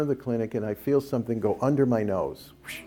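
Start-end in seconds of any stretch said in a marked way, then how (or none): 2.6-2.8 quick airy whoosh noise; imitated whoosh. Telling of something passing under their nose, imitated whoosh. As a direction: mimic something rushing past. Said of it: "just like that".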